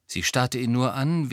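usual speaking voice

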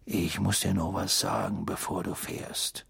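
tense, low, hushed father figure. A narrator's reading. Mister Weasley takes Harry aside before departure, tense and hushed. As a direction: say it strained, soft and low. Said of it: "mit angespannter Stimme".

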